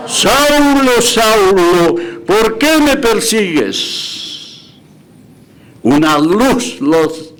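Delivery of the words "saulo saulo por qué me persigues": voice of the Lord. Acted. Preacher voicing Jesus calling Saul from heaven, high and slow. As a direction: call down from the sky, then slow the question.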